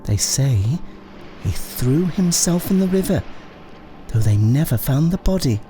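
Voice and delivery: high-pitched, quick character voice with swooping tune; gossiping neighbour. A narrator's reading. Neighbour telling Dorothy about the son next door, high gossipy voice. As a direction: tell it as eager gossip, pitched well up.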